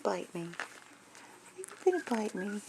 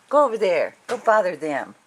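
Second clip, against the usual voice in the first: loud shooing; 12 dB louder than usual. Real, not acted. Shooing dogs away.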